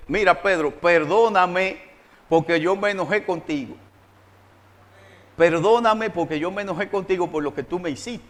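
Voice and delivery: low, softened voice of contrition; humble apology. Acted. Preacher showing how to apologise to a brother, humbly and softly. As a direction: ask forgiveness quietly and humbly.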